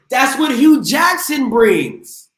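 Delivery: high, slow, loud shout on the last name; hyped-up shout. Real, not acted. Radio host shouting out a coach's name to close the point, booming. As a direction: build to a big slow shout like a closing argument.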